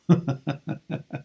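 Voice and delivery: real chuckling laugh; a run of short laughs. Real, not acted. Speaker amused by own remark, laughing.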